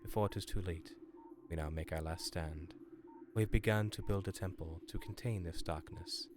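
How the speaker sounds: low, soft solemn reading voice; dramatic lore reading. A narrator's reading. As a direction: read it like an ancient record. Reads lore log aloud.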